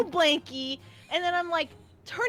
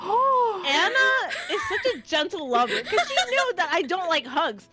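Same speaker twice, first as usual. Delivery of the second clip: higher, louder words broken by laughter; laughing while telling; 4 semitones higher, 4 dB louder than usual. Real, not acted. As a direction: talk through giggles. Laughing through the story.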